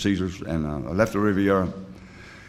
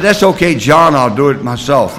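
high and loud, pushing the offer away; loud refusal; 5 semitones higher, 9 dB louder than usual. Retold or imitated. Turning down his sponsor John's help, in a loud refusal. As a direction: wave him off loudly, almost a shout.

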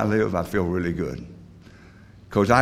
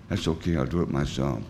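quiet echo; 7 semitones lower, 7 dB quieter than usual. Shown as a demonstration. A sober man echoing his old refusal, softly. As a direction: lower and softer, a rueful murmur.

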